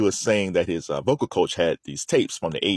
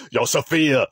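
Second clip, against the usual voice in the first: put-on New York gangster accent; 4 semitones higher than usual. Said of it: "it almost has a D M X feel to it".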